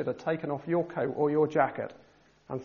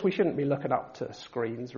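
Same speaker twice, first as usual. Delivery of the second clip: ordinary talk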